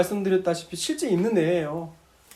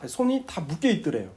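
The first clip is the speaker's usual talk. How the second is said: ordinary talk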